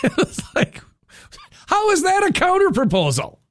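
high, disbelieving laugh into the question; incredulous. Real, not acted. Host on Iran's counteroffer, laughing in disbelief. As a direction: squeak out the disbelief.

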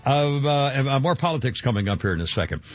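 ordinary talk, nothing marked

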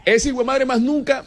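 ordinary talk, nothing marked

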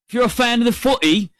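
put-on high, hammy British accent on footy. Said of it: "There's a terrible British accent".

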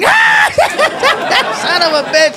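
high acted guffaw into words; cop dying laughing. Cop amused by a heckler, acting out helpless laughter. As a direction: crack up hard, then talk through it. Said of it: "The cop starts dying".

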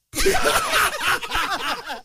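a high, hearty burst of laughter. Real, not acted. Host laughing hard before his rallying cry. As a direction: let the laugh explode.

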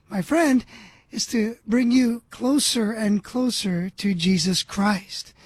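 ordinary talk

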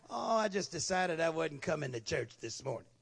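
low, subdued "oh, I just" excuse; offhand excuse. Acted. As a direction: play an absentee casually shrugging off church. Voices an absentee's lame excuse.

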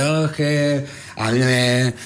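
high, slow, drawn-out hesitation; imitating a colleague. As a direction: mimic a halting speaker, stretching the words.

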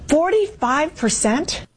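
stunned rise on the figure; surprised. Real, not acted. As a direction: let the number hang in shock. Shocked anchor repeats poll figure.

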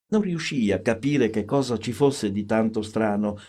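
usual speaking voice

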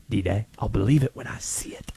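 low, hushed repeat of a doubt; conspiratorial near-whisper. Acted. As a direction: sink to a low whisper, as if confiding. Repeats the sign-off, trailing into whisper.

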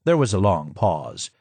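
plain reading voice

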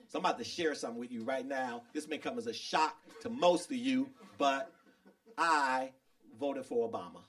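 low, hushed, halting admission; mock-grave confession. Comic confesses a shocking secret to the crowd, low and hushed. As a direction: share it softly like a shameful secret, pausing before the reveal.

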